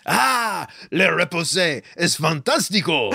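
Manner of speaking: hammed-up French accent; foreign accent in french. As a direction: fake French with Spanish mixed. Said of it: "Still not French".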